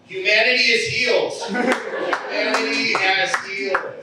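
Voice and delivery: high, loud, exultant declaration; triumphant proclamation. Acted. Triumphant punchline, proclaiming the sign proves it.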